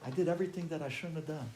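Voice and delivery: very soft, halting apology; ashamed confession. Retold or imitated. Son admitting he skipped yeshiva, hushed ashamed apology.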